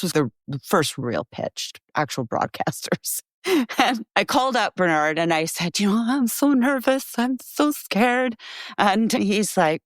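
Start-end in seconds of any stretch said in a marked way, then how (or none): 5.8-8.4 higher-pitched mock fright; retold nervousness. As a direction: act out your nervous younger self, pitched up. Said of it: "I was very, very nervous".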